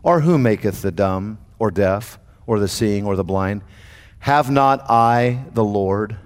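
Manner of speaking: slow, low solemn voice of God; the Lord speaking low and slow to Moses. Acted. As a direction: intone it like the Lord rebuking. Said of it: "The Lord said unto him".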